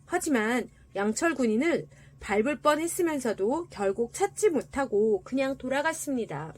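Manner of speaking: ordinary delivery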